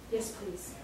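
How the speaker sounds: soft, tearful whisper; choked with tears. A narrator's reading. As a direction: barely get it out through tears.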